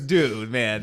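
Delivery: ordinary talk, nothing marked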